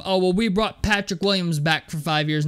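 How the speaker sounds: ordinary talk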